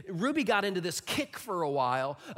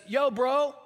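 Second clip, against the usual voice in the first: casual brother greeting; 6 semitones higher, 7 dB louder than usual. Retold or imitated. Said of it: "somebody says".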